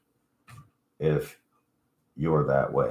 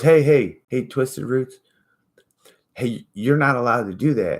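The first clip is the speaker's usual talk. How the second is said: mock scolding interjection, higher and louder; butting in; 6 semitones higher, 5 dB louder than usual. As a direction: cut in like a know-it-all calling someone out. Retold man butting in, scolding.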